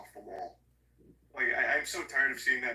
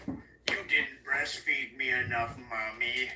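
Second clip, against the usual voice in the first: slow, louder put-on creepy voice; mock demon child; 4 dB louder, much slower than usual. Possessed boy taunting his mom, in a slow creepy put-on voice. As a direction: drag it out like a cheesy possessed kid.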